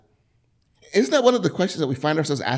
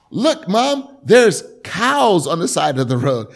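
put-on excited kid; 3 semitones higher, 8 dB louder, slower than usual. Child on road trip calling out.

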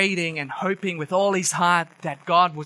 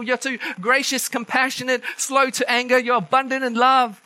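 raised, whining accusation to God; whiny complaint; 6 semitones higher than usual. Retold or imitated. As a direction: complain like a sulking prophet. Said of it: "this is what he's saying".

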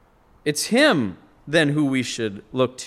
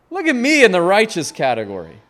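louder and higher through laughter; laughing mock boast; 5 semitones higher, 5 dB louder, faster than usual. Preacher mocks self-righteous pride, laughing out loud. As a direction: laugh while hamming up the proud Pharisee.

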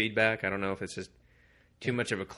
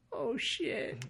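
much higher and softer, a tiny scared squeak; squeaky mock fright; 6 dB quieter than usual. Doctor realizes the danger, high squeaky mock fear. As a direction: sound like you just realized you're doomed.

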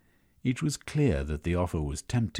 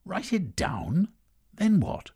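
much higher, baffled outburst; incredulous toff; 13 semitones higher than usual. A narrator's reading. Lord Gorge to Misanthrope, high incredulous question. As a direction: sound utterly taken aback.

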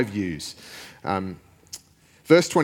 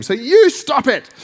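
much higher, louder, slower mock yell; yelling back; 9 dB louder, slower than usual. Patient yelling back at the therapist, hammed-up yell. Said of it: "yelling back to him".

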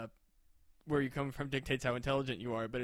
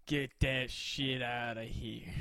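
slow, mock Southern drawl; dumb Southern voice; 2 semitones lower, much slower than usual. Scene: scoffing at bee science centuries ago, dumb Southern voice. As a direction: put on a dim-witted Southern accent. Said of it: "use Southern accents as the dumb voice".

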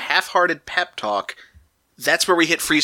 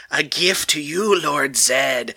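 creepy henchman voice; 2 semitones lower, much slower than usual. Retold or imitated. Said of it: "His voice is amazing".

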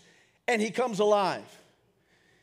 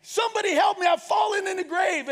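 high-pitched acted cry for help; mock yell for rescue, voice pitched up; shouting for help; 8 semitones higher than usual. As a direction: play a trapped man calling out. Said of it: "he's yelling, and he's, and he's shouting".

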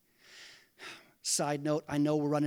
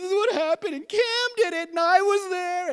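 much higher, louder whiny pleading; tearful kid confession; 12 semitones higher, 7 dB louder than usual. Retold or imitated. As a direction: whimper it out like a guilty child. Boy confesses to grandpa.

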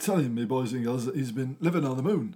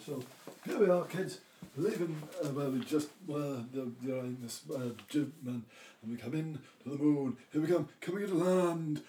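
softer, as if receding from the mic; away from the microphone; 7 dB quieter than usual. Pretend. Storyteller flies the kids to the moon, off-mic. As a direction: drift off-mic to fake a space landing. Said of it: "away from the microphone".

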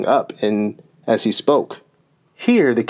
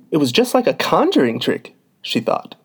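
plain reading voice

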